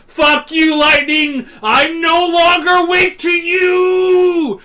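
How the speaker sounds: high, loud, slow shouted defiance; mock defiant shout. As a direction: roar it at the sky. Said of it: "he's like".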